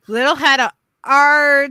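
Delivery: excited exclamation. Real, not acted. Friend reacting to news of a toy, excited. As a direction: stretch it out, rising into a loud finish.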